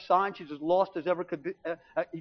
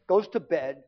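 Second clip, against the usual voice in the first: usual speaking voice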